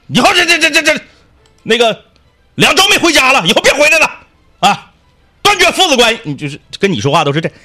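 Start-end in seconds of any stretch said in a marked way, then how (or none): 0.1-6.2 high, loud scolding grandpa; angry old man. Retold or imitated. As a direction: berate your grown son, high and loud. Grandpa scolding his son. (爷爷骂儿子)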